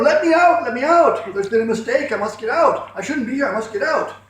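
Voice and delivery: high, loud panicked screaming; screaming shout. A narrator's reading. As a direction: scream to be let out. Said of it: "began to scream and shout, louder and louder".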